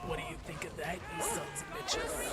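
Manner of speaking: put-on raspy old lady voice; foul mouthed granny. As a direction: croak it like a cranky old granny. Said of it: "what if I do it in the old lady voice?".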